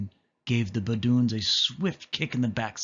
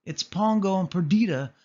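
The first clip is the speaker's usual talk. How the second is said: crying out happily; 9 semitones higher than usual. A narrator's reading. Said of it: "cried Nanny".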